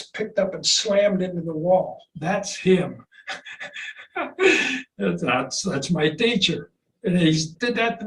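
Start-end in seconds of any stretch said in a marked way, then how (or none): 3.3-4.9 laugh. Real, not acted. Laughing at teacher photo.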